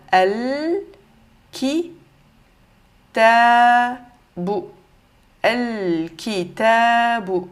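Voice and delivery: "Al-kitabu" slow, syllable by syllable, with a long L; lam prononcé. Shown as a demonstration. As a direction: sound out "Al-kitabu", holding L and long A. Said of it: "on va le prononcer normal". (« Al-kitabu » détaché, L bien prononcé)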